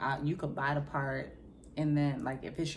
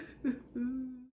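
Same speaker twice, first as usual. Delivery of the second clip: mock sob; 8 semitones higher, 3 dB quieter than usual. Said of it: "boohoo crying".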